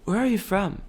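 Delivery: normal talking voice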